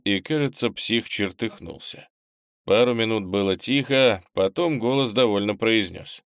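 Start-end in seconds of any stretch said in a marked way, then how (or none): none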